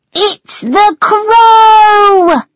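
high, loud, drawn-out terrified cry; fearful shout. A narrator's reading. Tweedledee warns of the crow, shouting in fear. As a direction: shriek the warning as you flee. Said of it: "Tweedledee shouted fearfully".

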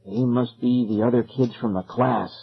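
usual speaking voice

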